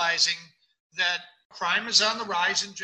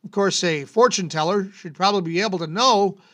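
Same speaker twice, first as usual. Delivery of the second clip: announcer read; 7 dB louder than usual. Acted. Reads bizarre-law segment over music.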